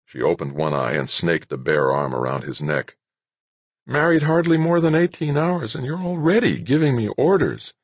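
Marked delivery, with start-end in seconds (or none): 3.9-7.7 higher, playful new-bride voice; teasing young wife. A narrator's reading. Claire teasing her new husband in bed, playful. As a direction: tease him from the pillow.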